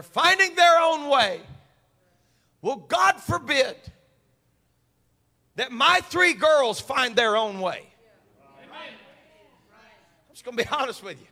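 loud, high preacher's build; preaching at full force. Acted. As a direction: drive it home to the congregation. Preaching resumes after the story.

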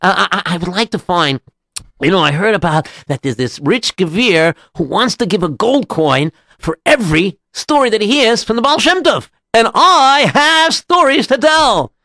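high voice, building excitement; eager rabbi. Acted. Rabbi tells a local about his stories, increasingly eager. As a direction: start hesitant, climb to a proud high finish.

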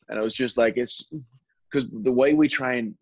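normal talking voice

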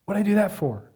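mildly exasperated with yourself; berating himself. Retold or imitated. Man who missed his deadline scolds himself, mildly berating. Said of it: "a torrent of really berating language".

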